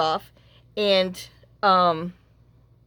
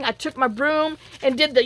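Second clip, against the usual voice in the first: usual speaking voice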